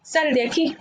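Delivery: light demo of an order; mock order. Shown as a demonstration. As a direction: play-act telling someone to get out. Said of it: "está haciendo un mandato".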